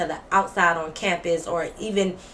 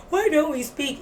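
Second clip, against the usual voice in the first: high-pitched mimicry; mocking imitation; 7 semitones higher than usual. Mocking the roommate talking with her friend, high mimicry. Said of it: "with your friend talking about".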